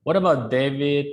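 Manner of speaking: ordinary talk, nothing marked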